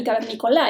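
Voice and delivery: cavernícola stressed wrongly on the last syllable, LA; misplaced final stress. Shown as a demonstration. Teacher showing a wrong word accent, stress on final LA of cavernícola. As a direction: push the weight onto the final LA of cavernícola. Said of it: "Eso es el acento de palabra".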